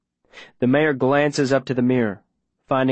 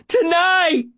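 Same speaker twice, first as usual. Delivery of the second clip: very high, sustained frantic yelling; desperate shout; 4 dB louder than usual. Acted. Boy giving up the attack plan, desperate shout. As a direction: scream out every detail to be heard. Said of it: "I shout it again and again".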